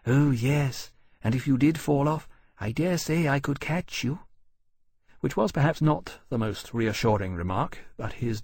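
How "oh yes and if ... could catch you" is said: slightly higher, eager dragon voice; earnest. A narrator's reading. Dragon reassures his captain's mother, earnestly. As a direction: offer it helpfully, in all innocence. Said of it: "Temeraire said earnestly".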